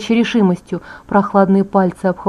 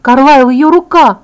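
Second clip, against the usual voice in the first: loud, higher, slow cry of horror; 4 semitones higher, 7 dB louder than usual. A narrator's reading. Edward showing Carlisle the bitten hand, horrified cry. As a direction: cry out aghast, stretching the words. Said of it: "в нём звучал неподдельный ужас".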